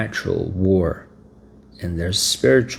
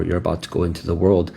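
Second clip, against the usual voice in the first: ordinary talk, nothing marked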